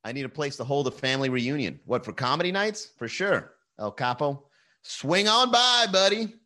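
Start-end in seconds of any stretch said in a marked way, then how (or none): 4.8-6.3 loud, high, drawn-out invitation; calling out. Real, not acted. Host inviting a viewer to comedy night, calling out. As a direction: sing out a big slow welcome.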